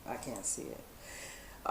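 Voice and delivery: soft, slow, almost voiceless mutter; muttered to himself. Real, not acted. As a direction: say it under your breath, slowly. Repeats to himself, frustrated.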